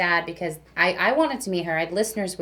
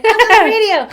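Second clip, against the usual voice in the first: a big, much higher and louder greeting; excited welcome; 15 dB louder, much faster than usual. Retold or imitated. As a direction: boom it out like a cheerful announcer. Voicing the gas station guy.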